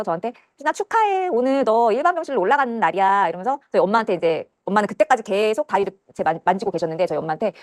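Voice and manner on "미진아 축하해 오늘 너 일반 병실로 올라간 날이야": high, drawn-out nurse voice; slow, lilting congratulations; congratulating. Retold or imitated. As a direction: imitate a nurse giving a patient good news.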